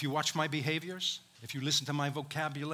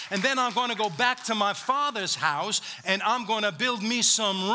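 impassioned Jesus promise; 6 semitones higher, 5 dB louder than usual. Acted. Preacher voicing Jesus promising his disciples, impassioned and loud. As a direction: pour out the promise with fervor.

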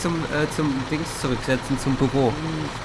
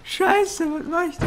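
mock panic; slower than usual. Real, not acted. Panicking at the controls.

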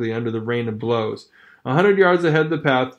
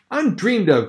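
mild cry; 7 semitones higher than usual. Acted. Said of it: "cried Mercedes".

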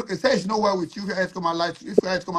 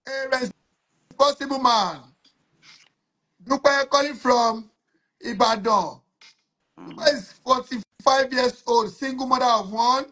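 higher, slow hype announcer read; live show announcer; 5 semitones higher, slower than usual. Acted. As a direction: present her like a host selling a guest. Announces caller to audience.

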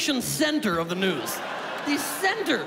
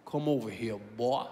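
put-on much lower drawling good ol' boy; 12 semitones lower, 5 dB quieter than usual. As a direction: growl it low like a Deep South local. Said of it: "he goes".

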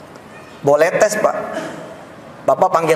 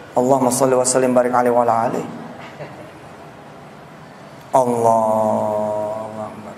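recited prayer; 8 semitones lower, 4 dB quieter than usual. Real, not acted. Reciting a blessing.

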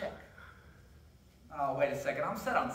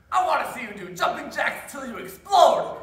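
grumpy shout; 7 semitones higher, 8 dB louder than usual. Acted. Grumpy old guy demands.

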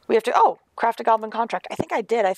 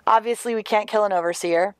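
normal talking voice

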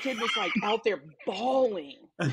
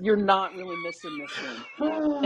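higher, half-shouted through a laugh; 10 semitones higher than usual. Retold or imitated. Telling the fan he's not missing her, laughing. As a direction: blurt it out laughing. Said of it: "And I was like".

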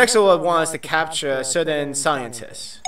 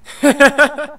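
character laugh; 11 semitones higher, 6 dB louder than usual. Acted. Villain reacts to the list.

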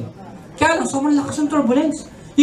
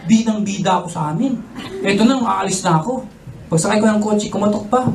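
ordinary delivery

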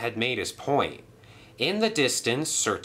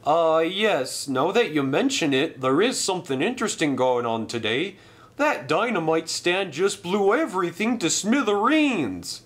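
higher, breezy voice; Bert, casual engine; 8 semitones higher than usual. Acted. Bert tells Duck about the blast, breezy. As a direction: shrug off the explosion lightly.

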